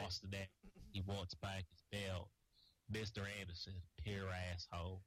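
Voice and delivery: slow, low wrestler impression; low, monotone impersonation, drawn out; Jeff Hardy. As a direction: drag the words out low, imitating a wrestler. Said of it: "doing a Jeff Hardy impression".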